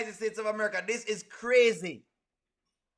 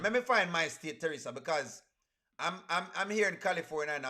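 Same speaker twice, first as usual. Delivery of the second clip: usual speaking voice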